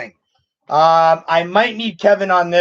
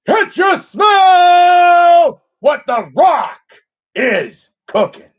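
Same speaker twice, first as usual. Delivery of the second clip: slow, loud, higher booming catchphrase; The Rock impression; 12 semitones higher, 5 dB louder, much slower than usual. Streamer tries The Rock for chat, slow shouted catchphrase. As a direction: bellow the catchphrase like a wrestling promo. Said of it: "if I'm doing Dwayne Johnson".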